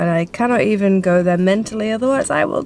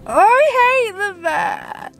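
high, slow drawn-out wail; wailing complaint; 11 semitones higher, much slower than usual. Real, not acted. Owner dreads the vet, high slow wail. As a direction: stretch it into a high whine.

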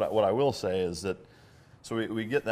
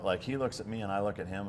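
usual speaking voice

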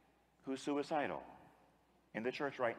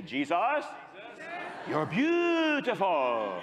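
higher, louder and very slow; slow, fervent, louder worship cry; louder with unction; 6 semitones higher, 4 dB louder, much slower than usual. Shown as a demonstration. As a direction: pour fervour into every word. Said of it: "Say it a little louder, okay, with a little more unction".

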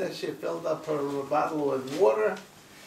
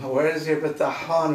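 usual speaking voice

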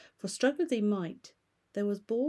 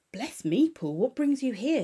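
raised, louder exclamation; crying out; 5 semitones higher, 6 dB louder than usual. Acted. Lawyer greeting the butler, raised surprised voice. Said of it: "he cried".

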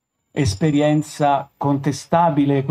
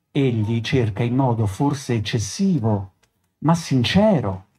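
plain reading voice

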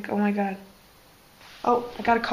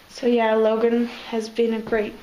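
normal talking voice

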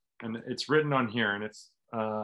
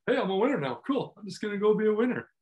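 higher-pitched, airy self-congratulation; mock breezy confidence; 8 semitones higher, faster than usual. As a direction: toss it off as if winning were effortless. Mocks instant, effortless winner mindset.

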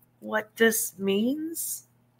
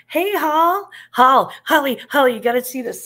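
calling out; 5 semitones higher, 5 dB louder than usual. Real, not acted. Calling friend over to look.

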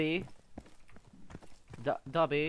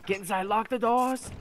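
building to a loud, higher command; urgent order; 6 semitones higher, 5 dB louder than usual. Pretend. Player fleeing attackers orders doors locked, urgent order. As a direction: order everyone to safety, getting louder.

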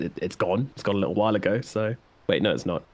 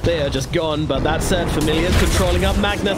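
play-by-play; 6 semitones higher, 4 dB louder than usual. Real, not acted. Hyped live play-by-play call.

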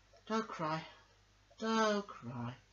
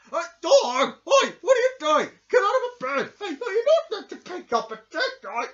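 loud, higher yelling; angry shouting; 15 dB louder than usual. Acted. George yelling at Dog to leave the body, angry shout. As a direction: tell him off hard. Scolding Dog grabbing the corpse.

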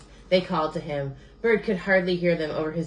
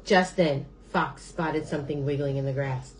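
ordinary delivery, nothing marked

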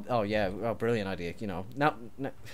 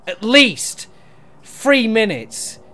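louder, higher, slowing on the punchline; mock-indignant shout; 4 semitones higher, 9 dB louder than usual. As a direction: overplay the outrage. Threatening partner over build.

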